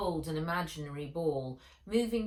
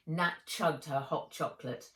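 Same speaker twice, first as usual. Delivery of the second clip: plain reading voice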